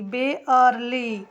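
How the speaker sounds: बेयरली stretched slowly, "be-ar-li"; slow बेयरली down so each sound is heard; slow repeat. Shown as a demonstration. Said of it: "spelling देखिए". (बेयरली धीरे-धीरे, खींचकर)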